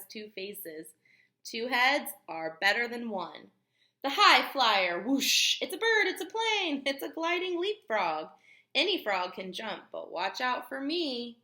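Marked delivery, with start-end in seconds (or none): 4.0-8.3 louder and higher, like a hype announcer; playful announcer. Acted. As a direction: sell the gliding frog like a superhero. Reading super frogs playfully.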